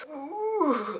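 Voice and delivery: high, soft strained ooh. Retold or imitated. Legs giving out, mimics the strain.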